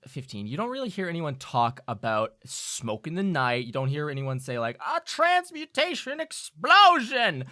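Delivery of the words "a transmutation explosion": mock gossip cry. Imagined gossip exclamation.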